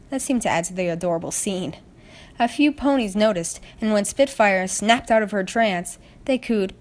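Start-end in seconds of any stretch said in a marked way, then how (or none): none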